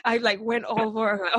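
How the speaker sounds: ordinary talk, nothing marked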